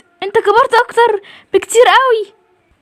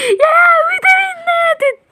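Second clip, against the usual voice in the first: high wailed grief; wailing; 9 semitones higher than usual. Acted. Sister wails to the family over Talin's death. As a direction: cry it out in despair.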